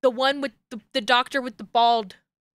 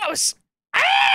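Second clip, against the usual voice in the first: high shrieking ah; real exasperated scream; letting out a scream of disbelief; frustrated scream; 13 semitones higher than usual. Real, not acted.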